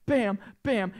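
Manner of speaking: normal talking voice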